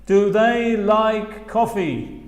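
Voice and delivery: slow, clear "Do they like coffee"; slow demo question; slow model question. Shown as a demonstration. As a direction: model it slowly. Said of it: "las posibles respuestas son".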